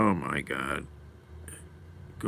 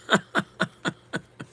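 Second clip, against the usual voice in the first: voiceless chuckle in quick bursts; real amused chuckling; laugh. Real, not acted. Streamer amused by viewer's comment, chuckling.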